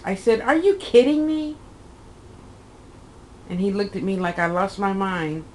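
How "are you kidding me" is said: high, loud disbelieving retort; incredulous outburst. Retold or imitated. Scoffing at the doctor who wants to fetch a nurse, incredulous. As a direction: fire it back in total disbelief.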